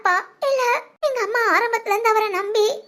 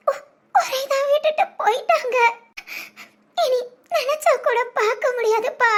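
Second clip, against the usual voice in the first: crying; 2 semitones higher than usual. Acted. Daughter grieving her absent father.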